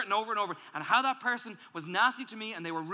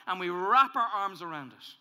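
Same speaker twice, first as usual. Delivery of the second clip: ordinary delivery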